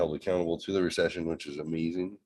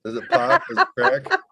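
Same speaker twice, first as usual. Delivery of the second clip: each question climbs higher, ending in a squeaky laugh; rising mock questions; 5 semitones higher, 3 dB louder than usual. Dad mocking his daughters' slang, climbing ever higher. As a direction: push every slang word up until the voice cracks.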